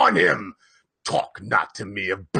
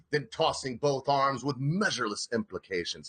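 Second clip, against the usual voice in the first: ordinary delivery, nothing marked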